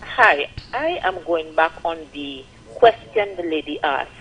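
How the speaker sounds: usual speaking voice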